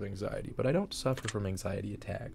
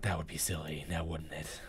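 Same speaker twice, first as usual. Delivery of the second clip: creepy whisper; 5 semitones lower, 5 dB quieter than usual. Acted. Teasing the unseen questioner.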